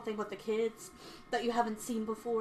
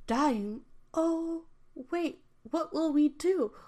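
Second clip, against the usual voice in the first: high, rising alarm, a touch of fear; panicked fairy; 6 semitones higher, 3 dB louder, slower than usual. Acted. Little fairy hearing the Sun King is dying, higher and alarmed. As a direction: gasp it out in alarm, pitched up. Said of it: "Little meadow grass started to cry".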